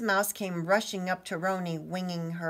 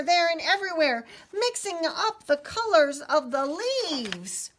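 high, animated storybook narration; swooping storyteller's voice with stretched key words; excited story reading; 8 semitones higher, 7 dB louder than usual. A narrator's reading. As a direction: build the drama for young listeners. Reads story to children.

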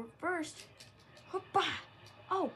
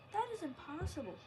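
usual speaking voice

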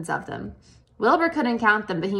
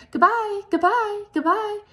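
very high small calling voices; tiny distant goodbyes; 12 semitones higher, 3 dB louder than usual. A narrator's reading. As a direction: call out like tiny spiders. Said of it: "Cries of".

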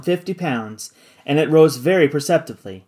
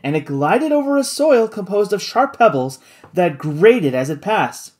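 ordinary delivery, nothing marked

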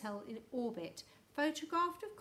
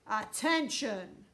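slow, booming call to attention; booming loudspeaker voice; 7 dB louder, much slower than usual. A narrator's reading. Hidden loudspeaker breaks the silence in the room, booming. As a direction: boom it out like a loudspeaker announcement. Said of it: "a tremendous booming voice came out of a loudspeaker".

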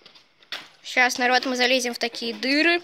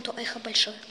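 hushed; 5 dB quieter than usual. Real, not acted. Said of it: "Тут очень слышно".

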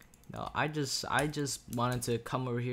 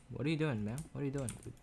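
normal talking voice

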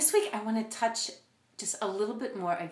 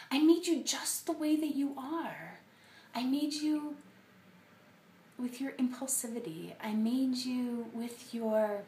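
higher, gentle, playful reassurance; tender loving God; 5 semitones higher than usual. Retold or imitated. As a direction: reassure them like a loving parent. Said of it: "what if instead he was saying".

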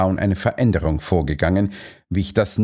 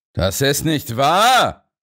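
a caught man shouting it down; 5 semitones higher, much slower than usual. A narrator's reading. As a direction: fling the denial back at him. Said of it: "schrie er".

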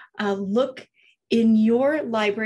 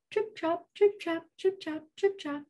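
higher, lightly tapped trip-trap; light pitched-up hoofbeats; medium hooves; 6 semitones higher, 5 dB quieter than usual. Acted. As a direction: trot away in little rhythmic syllables. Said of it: "off went the medium-sized billy goat".

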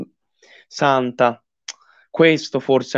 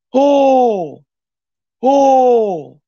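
loud high joyful whoop; joyful shout; 8 semitones higher, 4 dB louder than usual. Real, not acted. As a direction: let out a loud happy cry. Goal celebration live on stream.

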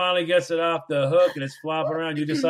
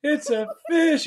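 mock excited; 11 semitones higher, slower than usual. Repeating her squeal mockingly.

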